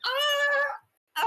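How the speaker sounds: high, loud drawn-out "aah"; long high cry of delight; loud squeal. Real, not acted. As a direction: squeal loudly at something adorable. Player melts at creature's plea.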